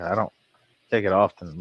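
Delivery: ordinary talk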